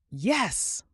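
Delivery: a mild glad exclaim at finding his axe; happy cry. Acted. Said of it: "Ben cried happily".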